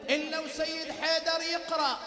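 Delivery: loud, high sermon build-up; impassioned preacher's cry; fervent preaching. Acted. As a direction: call out to the congregation. Preacher raises voice again.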